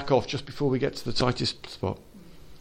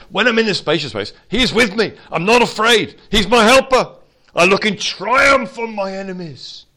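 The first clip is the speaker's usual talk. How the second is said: building, louder and higher; triumphant; 8 semitones higher, 6 dB louder than usual. Acted. Preacher proclaiming victory over enemies, building up. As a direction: swell into triumph.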